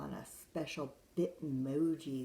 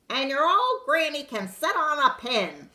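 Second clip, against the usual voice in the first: high, loud taunting yell; pig yelling; 12 semitones higher, 15 dB louder than usual. Acted. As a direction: jeer it at the wolf. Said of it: "Then the third little pig yelled".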